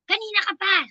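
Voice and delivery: mock-impatient employer scolding; scolding boss. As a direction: snap at her for being late.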